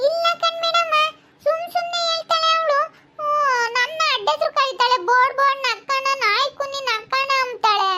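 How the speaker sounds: put-on high, whiny little-kid voice; aggrieved child. Child complaining to madam about name-calling, high whine. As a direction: protest to teacher like a hurt child.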